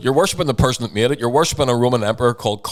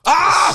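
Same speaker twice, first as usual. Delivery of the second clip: 1 frustrated scream; 5 dB louder than usual. Real, not acted. Fury at Bryce Mitchell's claim.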